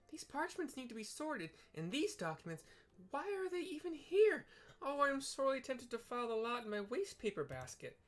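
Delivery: high, fussy, frantic little receptionist; flustered Tataru. Acted. Tataru sorting a messy desk, high and frantic. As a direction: squeak out the fuss of a swamped clerk.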